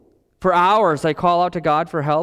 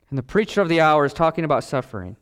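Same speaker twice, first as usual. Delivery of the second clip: ordinary talk, nothing marked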